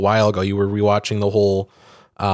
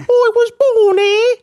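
hammed-up British accent, much higher and louder; a mock British accent pitched way up; 6 dB louder than usual. Mocking the British actor's homecoming in a British accent.

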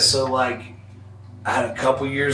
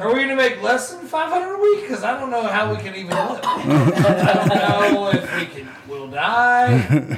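much higher, half-laughing mock panic; panicked broke wrestler; 8 semitones higher than usual. Retelling his fear to the podcast hosts, mock panicked squeal. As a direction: squeak it out like you're terrified of going broke. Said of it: "we're just like".

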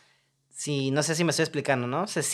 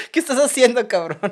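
laughing exasperation; 8 semitones higher, 6 dB louder than usual. Real, not acted. Laughing mock exasperation at actor.

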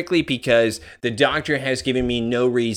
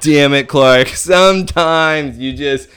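louder, laughing mock scolding; mock inner Clark; 3 semitones higher, 6 dB louder than usual. Clark scolding himself in his head, laughing mock voice. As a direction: scold yourself through a laugh. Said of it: "Clark is even thinking to himself".

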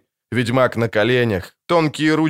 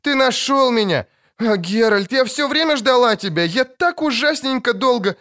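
put-on high child's voice, happy and pleading; the girl in the witcher's arms, overjoyed; joyful little girl; 11 semitones higher than usual. A narrator's reading.

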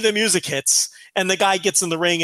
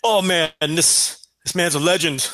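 lazy mock Vampiro. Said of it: "He was saying".

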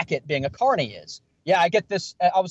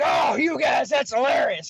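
delighted carny; 7 semitones higher than usual. Retold or imitated. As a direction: whoop it up, amused. Carny cracking up at the tasing.

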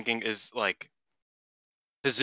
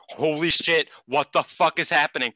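retold shock; 4 semitones higher, 4 dB louder than usual. Retold shocked messages seen live.

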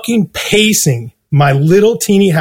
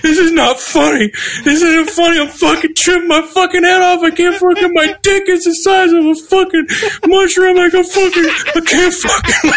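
much higher, frantic tripping voice; high panicked whine on the phone; 12 semitones higher than usual. Retold or imitated. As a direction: squeal it out like you're losing your mind. Retold panicked call to publicist.

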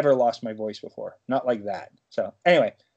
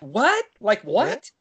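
squeaky, much higher "What?"; mock confusion; 10 semitones higher, much slower than usual. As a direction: play baffled, shooting the pitch way up. Joins the mock bewilderment.

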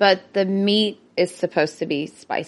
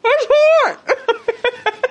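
high squeaky voice; 14 semitones higher than usual. Pretend. Echoing partner, high squeal.

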